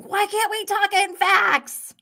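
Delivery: high mock yell of frustration; imitated yelling. Host imitates Lisa yelling at the group, high mock yell. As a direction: pitch it up like an exasperated shout. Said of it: "Lisa's yelling".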